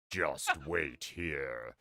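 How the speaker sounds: slow, low creepy voice; creepy zombie voice. Acted. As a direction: drag it out like a ghoul. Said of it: "this thing in the middle of the night".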